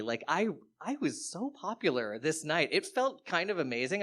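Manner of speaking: ordinary delivery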